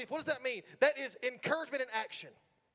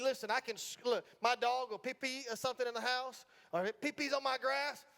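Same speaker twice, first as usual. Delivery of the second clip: usual speaking voice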